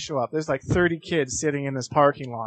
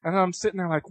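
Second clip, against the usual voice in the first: ordinary delivery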